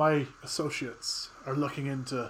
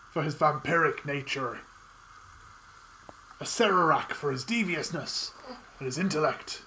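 megalomaniac rant. Acted. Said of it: "cheesy performer thing".

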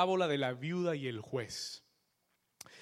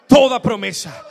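full shout; 6 semitones higher, 19 dB louder than usual. Shown as a demonstration. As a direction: shout it at full force, even louder.

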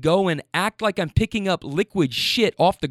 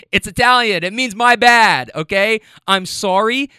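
emphatic explanation; 3 semitones higher, 6 dB louder than usual. Retold or imitated. Explaining mea culpa to lady.

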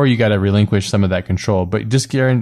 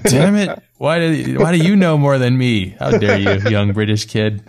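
mock outrage; 5 semitones higher, 3 dB louder than usual. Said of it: "And you're like".

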